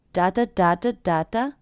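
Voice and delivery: rhythm of a phrase on "da", strong then weak; stress pattern. Shown as a demonstration. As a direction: beat out big-small pairs on "da", rising at the end. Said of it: "I also notice the stress".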